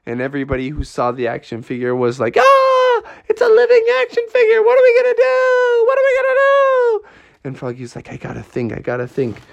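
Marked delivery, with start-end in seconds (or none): 2.4-7.0 very high, fast hysterical shrieking; hammed-up mass hysteria; crowd in panic. Onlookers seeing the living action figure, hysterical panic. 8.1-9.4 thinking to oneself. Acted. Froggy working out how to stop the toy, hushed self-talk.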